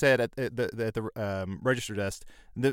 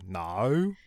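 mock Australian "No" bent through many vowels; imitated Australian drawn-out vowel; Australian accent; 4 semitones lower than usual. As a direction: stretch "No" into several syllables, Australian style. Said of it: "ten syllables where there should be two".

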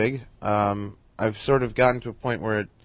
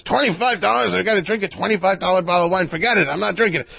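imitating Mel Brooks; 9 semitones higher, 4 dB louder than usual. Mel scoffs at the wine.

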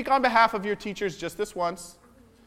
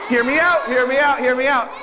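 emphatic call; 4 semitones higher, 6 dB louder than usual. Real, not acted. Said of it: "insists the children listen".

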